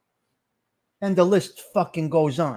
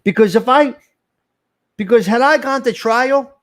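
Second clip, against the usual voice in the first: loud, raised-pitch agitation; aggravated; 4 semitones higher, 8 dB louder than usual. Real, not acted. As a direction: burst out louder, breaking off and restarting. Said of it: "I'm fucking aggravated right now".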